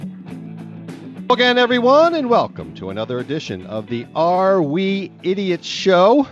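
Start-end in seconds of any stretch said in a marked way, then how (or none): none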